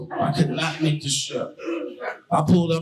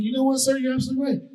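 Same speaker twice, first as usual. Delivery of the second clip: high, sarcastic politeness; mock agreement; 9 semitones higher, faster than usual. The comic mock-agrees with the homeless man, high and sarcastic. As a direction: fake sincerity, sir.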